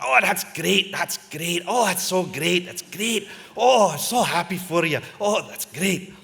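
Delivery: gushing Scottish congratulations; hearty Scottish well-wisher; Scottish accent. Retold or imitated. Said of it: "John had this thick Scottish accent".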